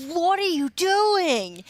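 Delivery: high, loud, drawn-out mock scream; screaming in exasperation. Player screams at a pug ruining the rune, exasperated. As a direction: yell it slowly in disbelief. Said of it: "you were just screaming".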